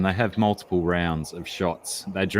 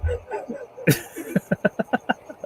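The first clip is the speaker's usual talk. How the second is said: laugh at own joke. Real, not acted.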